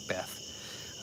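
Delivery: breathy hushed call to someone off mic; whispered aside. Real, not acted. Streamer quietly calling his wife off mic, whispered.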